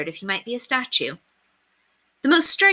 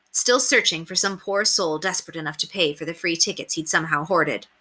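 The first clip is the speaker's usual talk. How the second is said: plain reading voice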